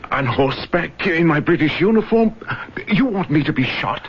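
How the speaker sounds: alarmed disbelief. Acted. Alarmed at escape plan.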